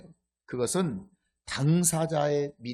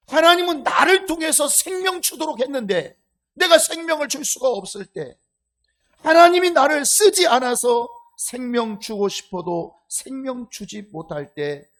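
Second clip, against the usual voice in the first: 높고 크게 외치는 설교조; 회중을 향해 힘주어 선포하듯; 9 semitones higher, 10 dB louder than usual. Acted. (목청 높여 강하게 설교하기)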